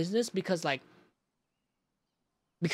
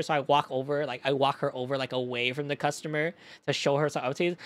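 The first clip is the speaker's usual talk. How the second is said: ordinary talk, nothing marked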